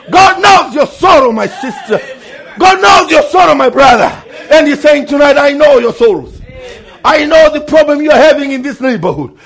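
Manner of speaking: loud, high, shouted sermon climax; fiery preaching. Acted. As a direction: preach it hard to the congregation. Preaching at full force.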